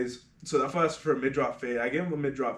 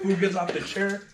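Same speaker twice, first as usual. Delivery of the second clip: normal talking voice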